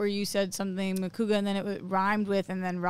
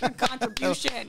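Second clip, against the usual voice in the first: mock pat on the back, pitched up; sarcastic self-praise; 4 semitones higher than usual. Shown as a demonstration. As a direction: drily congratulate yourself. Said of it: "I thought in my head".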